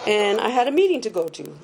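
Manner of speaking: ordinary talk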